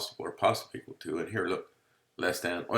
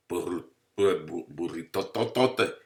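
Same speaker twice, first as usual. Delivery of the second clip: silly voice; 4 dB louder, slower than usual. Pretend. Playful stuttered silly word.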